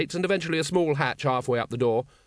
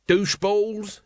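high scornful jeer; sneer; 8 semitones higher, 3 dB louder, slower than usual. Acted. Nobby sneers at the retreating guards, high and scornful. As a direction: spit the insult at their backs. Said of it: "he sneered".

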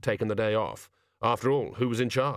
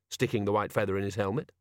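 plain reading voice

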